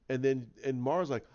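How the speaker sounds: usual speaking voice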